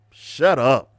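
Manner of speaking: usual speaking voice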